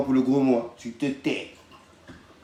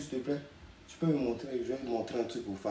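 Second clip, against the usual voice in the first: normal talking voice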